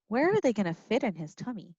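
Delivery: plain reading voice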